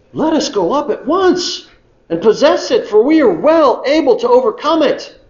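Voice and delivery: Caleb urging the people on, charged up; Caleb rallying. Acted. Caleb telling the fearful people to go, high rallying cry. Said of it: "And so Caleb stands up".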